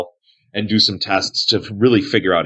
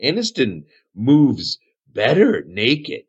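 slow, swooping higher hermit voice; Aniston, dotty cave hermit; 6 semitones higher, slower than usual. Acted. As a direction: explain it slowly as an odd loner.

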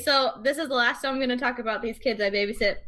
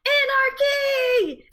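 much higher, very slow, drawn-out shout; shouted anarchy; 14 semitones higher, 3 dB louder, much slower than usual. Retold or imitated. Babysitter yelling over two screaming kids, a drawn-out shout. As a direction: belt it out like a battle cry. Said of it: "and I just went".

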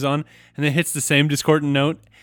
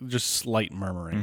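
normal talking voice

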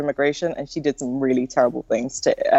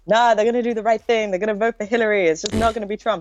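Jamaican radio callers; 6 semitones higher, faster than usual. Retold or imitated. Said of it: "Jamaicans on the radio were calling it".

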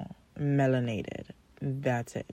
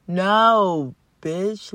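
loud no; 2 semitones higher, 5 dB louder than usual. Real, not acted. Rejecting the men.

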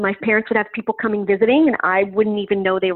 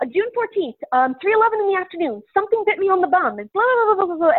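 high-pitched tumbling recital of details; excited spewing out; 9 semitones higher than usual. Retold or imitated. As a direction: blurt it all out in a much higher voice. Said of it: "I immediately started spewing out".

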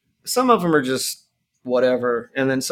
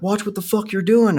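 imitating a stranger yelling at him, higher; mock yell of an angry hiker; retold angry yell; 8 semitones higher than usual. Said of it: "the guy yells".

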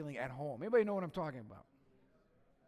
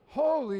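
"Holy" much higher and louder; emphatic "Holy"; 9 semitones higher, 11 dB louder than usual. Shown as a demonstration. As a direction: cry out "Holy" to lead the room. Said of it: "Everybody say holy".

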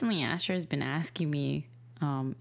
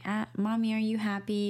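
put-on a higher, sweet little boy asking; child's question; 7 semitones higher than usual. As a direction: lightly play the toddler checking on mom. Mom voices her young son.

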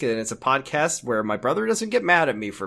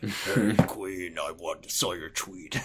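Steppenwolf voice; 7 semitones lower, 8 dB quieter, slower than usual. Pretend. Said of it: "Steppenwolf voice".

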